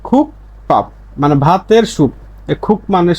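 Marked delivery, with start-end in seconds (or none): 0.1-0.9 খুক পাপ as two separate beats, p not b; corrected p reading. Shown as a demonstration. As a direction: say খুক পাপ with a voiceless p starting পাপ. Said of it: "তাহলে উচ্চারণটা এরকম হবে".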